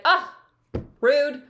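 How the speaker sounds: loud, high mock outburst "Ah, rude"; big bold outburst. Shown as a demonstration. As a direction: let it burst out, big and bold. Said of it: "big, bold expression".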